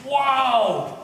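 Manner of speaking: high, bright burst of surprise; sudden amazed exclamation; surprised wow. Acted. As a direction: gasp it out in wonder. [name] discovers secret room.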